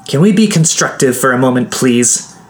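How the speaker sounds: yelled. A narrator's reading. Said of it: "Quinn yelled".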